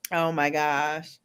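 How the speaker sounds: ordinary talk, nothing marked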